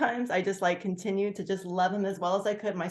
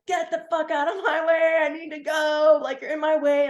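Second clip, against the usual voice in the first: high, pushy road-rage rant; irritated yelling; 9 semitones higher, 4 dB louder than usual. Retold or imitated. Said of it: "really irritated".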